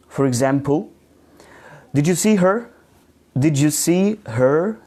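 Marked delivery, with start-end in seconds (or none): 1.9-2.7 "her" lifted high at the end of the question; rising intonation. Shown as a demonstration. Teacher shows students a yes-no question, rising intonation. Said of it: "your intonation rises at the end of the question". 3.3-4.8 slow question, drawn out and rising on "her"; rising intonation. Shown as a demonstration. Teacher repeats the example question for students, slow rising intonation. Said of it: "your intonation rises at the end of the question".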